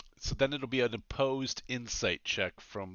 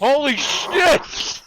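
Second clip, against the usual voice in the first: shocked shout; 14 dB louder, much slower than usual. Real, not acted. Shocked at huge damage roll.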